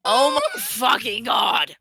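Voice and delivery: exasperated shout. Acted. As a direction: bellow it in exasperation. Exasperated outburst.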